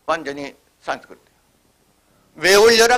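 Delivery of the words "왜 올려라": calling out. Retold or imitated. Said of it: "그러죠".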